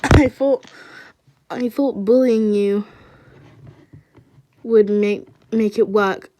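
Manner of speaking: loud, halting apology; tearful apology. A narrator's reading. Michael apologizing to Chris for bullying, choked up. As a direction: push it out in broken pieces. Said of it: "he said, crying".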